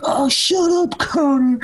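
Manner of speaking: high-pitched, mock-irritated telling-off; exasperated cousin. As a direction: imitate a cousin shooing someone away. Cousin scolding Connor, acted out.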